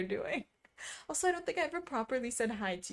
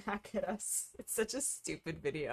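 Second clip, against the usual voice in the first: ordinary delivery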